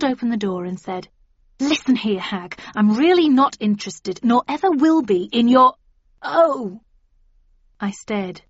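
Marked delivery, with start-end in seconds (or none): 1.6-5.7 loud, rising angry rant; angry telling-off. A narrator's reading. Narrator tells hag off. 6.2-6.8 surprised. A narrator's reading. Sees Gran at door.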